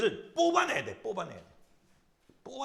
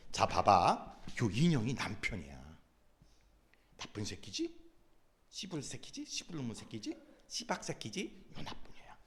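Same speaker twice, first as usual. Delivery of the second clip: acting out coaching the woman; 8 semitones lower, 8 dB quieter than usual. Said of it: "하면서 내가 재현 배우야".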